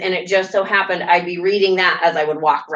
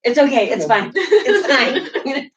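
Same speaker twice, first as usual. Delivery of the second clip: out of breath; 5 semitones higher, slower than usual. Retold or imitated. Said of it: "so winded".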